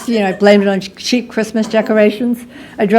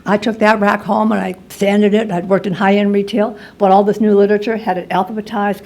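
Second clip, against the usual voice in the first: normal talking voice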